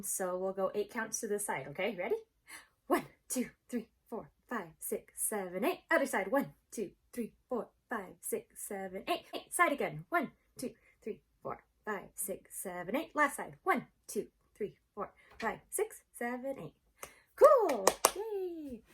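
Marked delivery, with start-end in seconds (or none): 2.9-16.7 brisk counted beats for a movement break; counting out a warm-up. Acted. 17.3-18.8 high, loud burst of praise; excited cheer. Real, not acted. Cheering after the warm-up.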